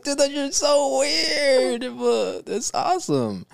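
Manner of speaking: slow, high, overjoyed pretend reaction; delighted exclamation. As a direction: gush as if it made your day. Imagining a friend's weird habit.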